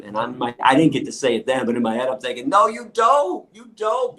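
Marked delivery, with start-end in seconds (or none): 2.5-4.2 defiant retort. Retold or imitated. Imagined retort to judge.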